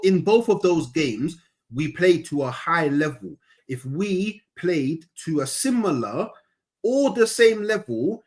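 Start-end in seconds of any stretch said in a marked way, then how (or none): 3.7-8.2 slow, deliberate word-by-word delivery; really slowly. Shown as a demonstration. Exasperated fan repeating his point to his debate partner, really slowly. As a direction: spell it out slowly, phrase by phrase. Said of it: "I'm gonna say it really slowly".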